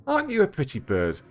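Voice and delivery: cooing pet owner. Acted. Man dusting beside his new parrot, cooing high. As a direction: talk sweetly to a bird.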